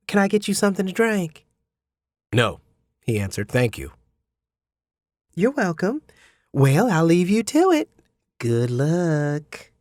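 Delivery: the gracious mother, courteous and formal; lady of the house, ushering him along; Mrs Hubbard. Acted.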